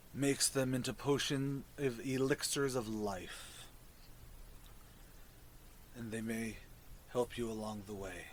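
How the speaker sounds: low, soft fungal creature voice; mushroom voice. Acted. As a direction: speak low and hushed like a mushroom.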